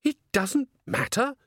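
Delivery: high, incredulous echoed question; taken aback. A narrator's reading. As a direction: throw it back high and stunned, rising at the end. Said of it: "felt that a leg had been kicked away".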